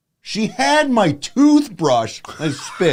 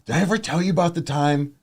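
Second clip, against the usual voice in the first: ordinary talk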